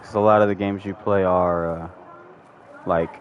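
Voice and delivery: ordinary delivery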